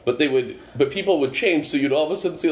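ordinary delivery

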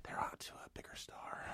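whispered bad news. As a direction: breathe it in a whisper. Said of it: "and they were like".

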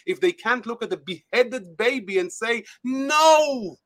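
pleading with rising, real emotion; emotional. Real, not acted. Guest to host about universities, rising into real emotional outburst. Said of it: "I'm sorry if I'm getting emotional".

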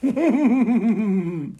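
high-pitched drawn-out laugh; high giggle. Real, not acted. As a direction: giggle high in a long rippling run. Laughing before reading the apology.

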